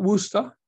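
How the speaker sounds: Worcester said wrongly as "WOO-stuh", rising; wrong pronunciation. Shown as a demonstration. Speaker showing newcomers how not to say the city, wrong pronunciation. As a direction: say Worcester with a long "oo", no r's. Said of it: "how to pronounce the name".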